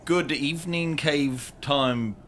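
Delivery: ordinary talk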